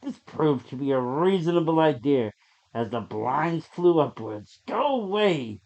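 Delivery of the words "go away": ordinary delivery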